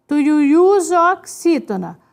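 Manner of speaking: normal talking voice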